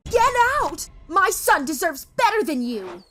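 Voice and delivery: shrill furious scream of a mother; angry mom screaming at the girlfriend. Acted. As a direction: scream her out of the house. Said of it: "screamed at me".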